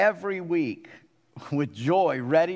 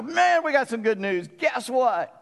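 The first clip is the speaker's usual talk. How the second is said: higher, eager burst of good news; excited telling; 8 semitones higher than usual. Retold or imitated. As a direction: blurt it out like you can't hold it in. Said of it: "you gotta go run out and go".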